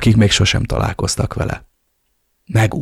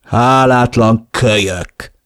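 drawn-out angry exclamation; slow, forceful scolding outburst; raised voice; 3 semitones higher, 4 dB louder, much slower than usual. A narrator's reading. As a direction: raise your voice and stretch the insult. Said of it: "emelte meg a hangját".

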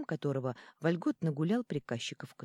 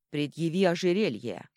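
loud stern command; demanding shout; 5 dB louder than usual. A narrator's reading. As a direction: bark the order. Ambassador demands the necklace.

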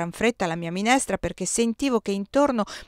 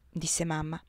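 plain reading voice

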